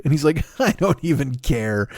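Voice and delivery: ordinary talk, nothing marked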